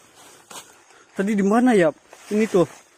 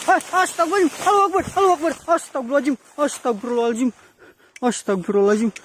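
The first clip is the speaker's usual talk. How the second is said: very high panicked cries of prayer; terrified; 9 semitones higher, much faster than usual. Real, not acted. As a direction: shriek the prayers in sudden terror. Sudden scare during ghost hunt.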